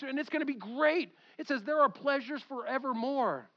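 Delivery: high, passionate pulpit excitement; fired-up preaching. Real, not acted. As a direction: preach it with real, rising-pitched enthusiasm. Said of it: "if I get a little fired up".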